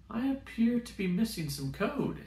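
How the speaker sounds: a puzzled ship AI, measured and odd; robot voice. Acted. Ship AI to the narrator, slow and puzzled.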